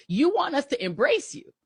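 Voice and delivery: pointed, rising accusation; emphatic accusation. Real, not acted. Host accusing right-wing viewers, pointed and firm. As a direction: lay the charge out firmly with a lift at the end.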